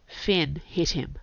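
plain reading voice

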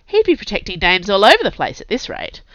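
plain reading voice

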